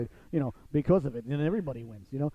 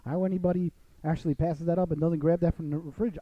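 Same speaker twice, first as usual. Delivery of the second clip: ordinary talk, nothing marked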